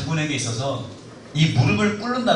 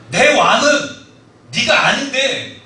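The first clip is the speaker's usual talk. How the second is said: impassioned protest; 7 semitones higher, 5 dB louder, much slower than usual. Retold or imitated. Voicing the general's pride.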